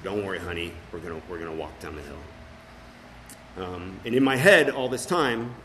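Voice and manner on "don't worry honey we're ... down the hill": soft, slow comforting dad; calm a crying child down gently; tender reassuring father to daughter; gentle reassurance. Retold or imitated. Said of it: "and I said".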